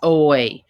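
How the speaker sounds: "Oi" sounded out loud and clear as a model; modelled oi sound. Shown as a demonstration. Teacher models "Oi" for first graders, loud and clear. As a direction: say "Oi" firmly for the class to copy. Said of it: "Everybody say oi with me".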